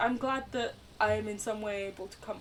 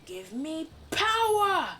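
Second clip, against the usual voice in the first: loud, slow, higher battle cry; dramatic cry; 8 semitones higher, 6 dB louder, slower than usual. Real, not acted. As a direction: demand power like a hero. Calling out in game.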